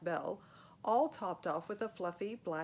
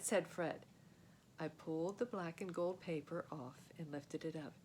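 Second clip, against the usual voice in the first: ordinary delivery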